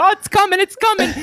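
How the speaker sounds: high, loud imitation of breathless anticipation; excited trainspotter. Imitating the trainspotter as the train approaches, excited cry.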